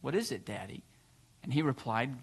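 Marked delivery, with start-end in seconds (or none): 0.0-0.8 low, hushed little question; quiet question. A narrator's reading. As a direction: ask it softly and low. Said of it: "so she quietly asked".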